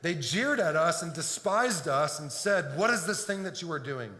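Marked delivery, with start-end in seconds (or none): none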